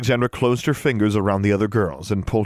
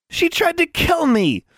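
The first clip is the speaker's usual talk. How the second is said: very high, drawn-out squeal; squealing accusation; 4 dB louder than usual. A narrator's reading. Tomo points at Anna accusingly, squealing. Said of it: "Tomo squealed".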